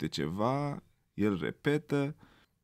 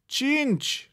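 much higher, loud little voice; eager child answer; 4 dB louder than usual. A narrator's reading. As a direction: say it without hesitating. Rabbit repeats wrong answer.

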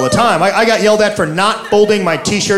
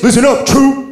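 drill sergeant; 5 semitones higher than usual. Retold or imitated. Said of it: "he would just get in my face".

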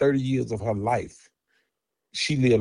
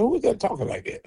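much higher, startled mock demand; retold indignant question; 10 semitones higher, 4 dB quieter than usual. Dad hearing cussing behind his daughter, retold indignation. Father alarmed by cussing man on phone.

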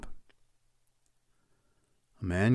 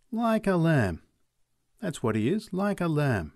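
higher, lively sales talk; horse seller; 6 semitones higher than usual. Acted. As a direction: sell the horse hard. McLeod praises the horse.

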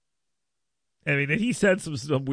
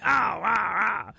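mock shout; 6 semitones higher, much slower than usual.

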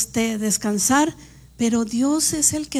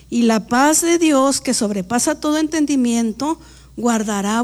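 louder, measured Bible reading; scripture reading; 5 dB louder than usual. Acted. Preacher reading Filipenses to the congregation, measured. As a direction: read the verse out to the congregation.

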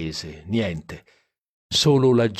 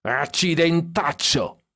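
put-on slow, higher cry of a happy drunk; a mild drunken holler, pitched higher; drunken yell; 8 semitones higher, slower than usual. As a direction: bellow it tipsily, stretched out. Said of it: "urlò Stan dietro".

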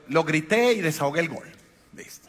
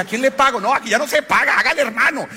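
excited voices; 8 semitones higher, 5 dB louder than usual. Retold or imitated. Confused exchange on the bus.

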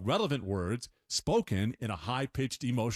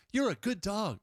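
higher sing-song dog praise; higher baby-talk "You're a good dog."; 8 semitones higher than usual. Shown as a demonstration. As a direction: coo it like praising a puppy. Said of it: "I can't get high enough".

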